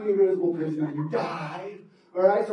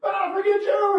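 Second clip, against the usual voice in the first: exasperated yell; 11 semitones higher than usual. Acted. Cassandra gives up, leaves.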